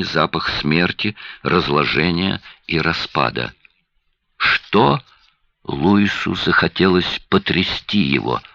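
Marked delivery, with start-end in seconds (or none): 4.4-5.0 urgent cry. A narrator's reading. Louis demands urgently.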